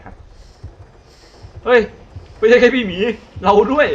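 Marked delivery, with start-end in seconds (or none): 1.7-3.9 high, loud panicked yell. Real, not acted. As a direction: shout in sudden fear. Realises he is moving too. (ตะโกนตื่นตระหนกเสียงสูงดัง)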